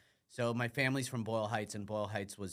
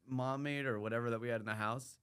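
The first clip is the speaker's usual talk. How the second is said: usual speaking voice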